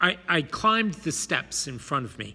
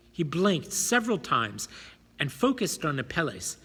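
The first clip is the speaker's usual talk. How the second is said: usual speaking voice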